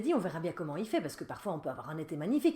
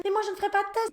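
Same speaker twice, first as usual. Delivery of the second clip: put-on very high, louder child's protest; little girl's whine; 7 dB louder, faster than usual. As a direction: squeak it like a stubborn little girl. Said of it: "ma fille qui disait".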